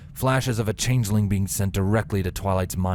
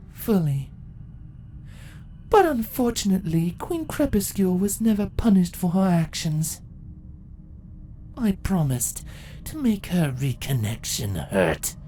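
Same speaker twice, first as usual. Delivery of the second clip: cold, imperious villain, higher pitched; proud empress telling her victory; ancient empress; 10 semitones higher than usual. Acted. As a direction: gloat over the long wait. Empress decree.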